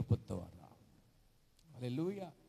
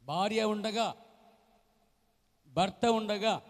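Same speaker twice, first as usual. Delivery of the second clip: loud and higher, spaced out; preaching emphasis; 7 semitones higher, 9 dB louder, much slower than usual. Real, not acted. Preacher pressing the point to the congregation, loud. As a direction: hammer each phrase.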